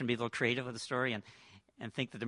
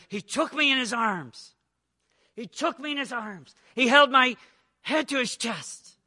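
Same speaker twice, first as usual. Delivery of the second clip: moved retelling; 8 semitones higher, 7 dB louder, slower than usual. Acted. Son quoted in song lyrics.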